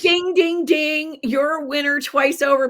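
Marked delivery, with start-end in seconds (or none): 0.0-1.2 high, ringing "ding, ding, ding"; winner's bell. Pretend. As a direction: imitate a game-show winner's bell.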